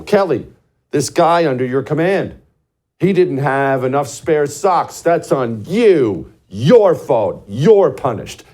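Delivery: loud, high-pitched dressing-down; stern superior's reprimand. Acted. Superior holding young Marine accountable for his men, loud stern reprimand. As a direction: come down hard on the one in charge, hitting each blame.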